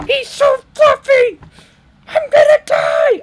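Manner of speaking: very high, loud, slow squeal building to a shout; cuteness squeal. Real, not acted. Fan overwhelmed by a fluffy monster, shrieking squeal. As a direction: squeal like it is too cute to bear.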